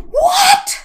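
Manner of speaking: high, loud yelled what; a sharp cry across the room; shout from the sidelines. Retold or imitated. Sister calling out from the sidelines, high yell. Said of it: "you hear".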